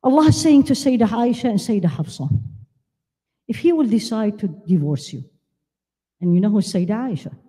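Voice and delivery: ordinary talk, nothing marked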